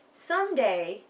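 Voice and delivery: ordinary delivery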